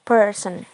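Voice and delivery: "person" with a firm first syllable, falling away; stress on PER. Shown as a demonstration. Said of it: "the stress is on per".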